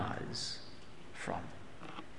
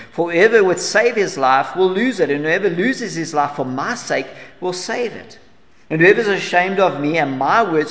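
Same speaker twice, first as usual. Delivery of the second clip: forceful scripture reading; 4 semitones higher, 6 dB louder than usual. Acted. Preacher reads Jesus' words forcefully.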